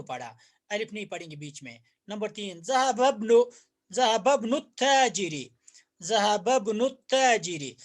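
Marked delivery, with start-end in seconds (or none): 2.6-7.8 slow, careful ज़हबब्नु ताजिरी; ज़हबब्नु drawn out, joined; slow model reading. Shown as a demonstration. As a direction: spell it out slowly for students. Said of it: "teacher models the sentence slowly".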